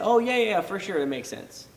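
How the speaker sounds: mock easy agreement. Mocking imagined easy agreement.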